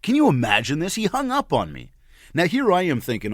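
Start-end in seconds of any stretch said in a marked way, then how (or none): 0.9-1.9 much higher-pitched disbelief; incredulous repeat. Real, not acted. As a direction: pitch it way up, as if asking them to believe it.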